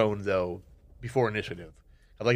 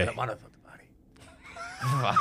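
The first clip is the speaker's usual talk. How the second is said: whispering; 2 semitones higher, 4 dB quieter, slower than usual. Real, not acted. Whispered joke aside.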